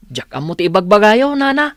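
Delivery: put-on high child asking mother; child voice pleading with nana; child's voice.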